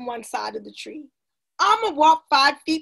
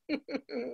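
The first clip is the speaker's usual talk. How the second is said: sly chuckle; 12 dB quieter than usual. A narrator's reading. Rafiki owning up to his trick, chuckling softly. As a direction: chuckle quietly at your secret. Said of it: "Rafiki winked and smiled".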